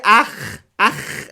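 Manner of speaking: "ach" with a loud rasped German-style throat sound; guttural "ach". Shown as a demonstration. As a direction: demo "ach", high and loud with a scraped ending. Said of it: "it's not a sound in French".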